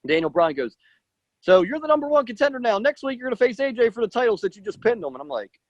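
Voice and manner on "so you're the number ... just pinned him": higher-pitched announcement, lightly acted; retold announcement in a raised pitch; imitated general manager. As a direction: play an official breaking big news.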